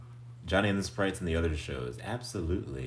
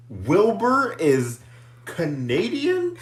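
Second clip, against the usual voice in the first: slow, drawn-out, higher goofy question; 11 semitones higher, 5 dB louder, much slower than usual. Pretend. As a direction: stretch it out like a cartoon goof. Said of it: "I said that, like, goofy".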